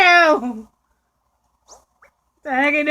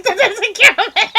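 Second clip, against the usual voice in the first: loud, high, laughing outburst; cracking up while complaining; 7 semitones higher, 7 dB louder than usual. Real, not acted. Frustrated at misplaced item.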